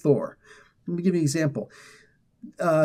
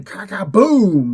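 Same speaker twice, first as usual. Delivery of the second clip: drawn-out, punchy boom noise; comic-book blast, louder and stretched; imitated explosion; 4 semitones higher, 6 dB louder, much slower than usual. Host imitating the blast off the roof, big slow boom.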